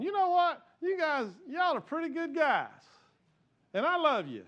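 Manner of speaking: high, loud West Texas voice for God; West Texas drawl. Pretend. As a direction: put on a big Texan drawl as God. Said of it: "y'all didn't know that God had a West Texas accent".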